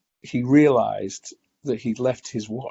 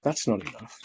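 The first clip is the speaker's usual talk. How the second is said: a hint of a different, softer voice in the head; a lightly voiced addiction whispering for more; inner voice; 4 dB quieter than usual. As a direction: say it like the craving speaking inside you. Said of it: "I just heard this voice go".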